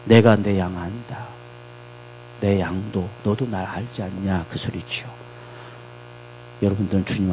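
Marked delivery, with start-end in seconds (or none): 0.0-4.5 gentle quote. Acted. Lord's gentle words voiced softly.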